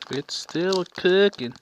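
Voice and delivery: slow southern drawl on cooking; southern accent. Real, not acted. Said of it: "Dang, I sounded so southern right there".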